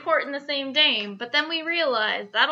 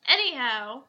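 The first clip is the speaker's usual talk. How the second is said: ordinary delivery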